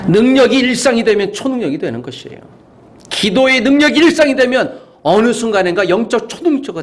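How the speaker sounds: high-pitched, insistent pulpit voice; fervent preaching. Real, not acted. Preacher urging daily prayer on the congregation, high and fervent. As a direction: press each clause home with fervour.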